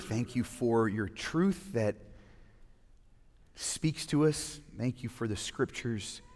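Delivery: soft, hushed prayer voice. Acted. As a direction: pray quietly and reverently. Opening prayer before sermon.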